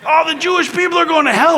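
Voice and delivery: high, loud mock hellfire preaching; hammed-up hellfire preacher; crazy preacher. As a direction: proclaim damnation high and loud. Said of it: "one of these crazy guys".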